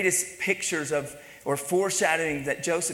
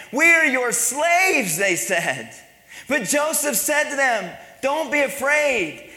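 loud, raised-pitch sermon delivery; full-force preaching; 7 semitones higher, 5 dB louder than usual. Real, not acted. Preaches the Joseph story at full force.

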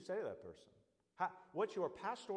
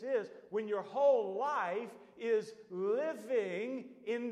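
full-force preaching; 7 semitones higher, 7 dB louder than usual. Acted. Preacher warning a sinner at the cliff edge, loud and urgent.

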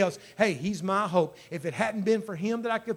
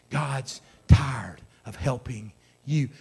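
slow, low, weighted words; discouraging inner voice; 6 semitones lower, slower than usual. Acted. Doubting voice wearing down the believer, slow and discouraging. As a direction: drag out the doubt in a lowered voice. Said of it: "the voice that says".

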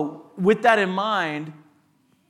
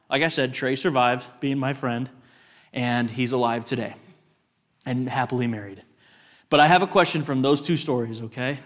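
usual speaking voice

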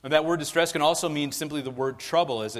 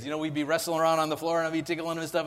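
ordinary delivery

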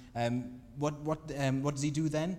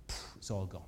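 hushed whisper after a puff of breath; awed whisper; 6 semitones lower, 7 dB quieter than usual. Acted. Preacher telling how fire consumed everything, whispered in awe. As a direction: breathe it out in wonder.

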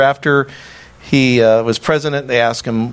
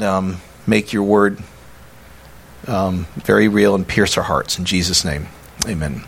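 hushed, reverent prayer voice; 3 semitones lower than usual. Real, not acted. Pastor praying before the sermon, hushed and reverent.

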